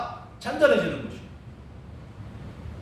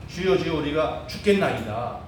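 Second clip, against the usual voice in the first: normal talking voice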